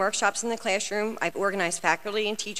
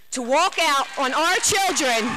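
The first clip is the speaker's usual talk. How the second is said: impassioned; 5 semitones higher, 4 dB louder, slower than usual. Real, not acted. Impassioned climax at the board meeting.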